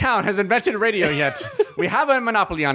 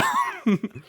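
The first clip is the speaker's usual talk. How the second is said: squealing laugh; 3 dB quieter than usual. Real, not acted.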